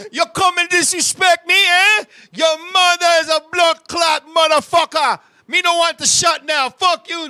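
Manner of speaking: put-on loud, high Jamaican accent cursing; Jamaican accent shouting. Jamaican man cursing out the nurse. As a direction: roar it in a Jamaican accent like you've been insulted. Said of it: "Cursed him out of his language".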